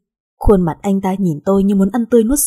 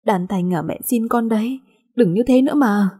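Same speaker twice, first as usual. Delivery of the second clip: tearful pleading; 2 semitones higher than usual. A narrator's reading. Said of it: "đau lòng mà khóc theo".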